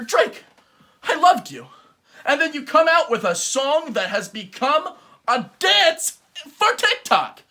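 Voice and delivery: high, loud betrayed cry; mock heartbreak. Betrayed fan addressing [name].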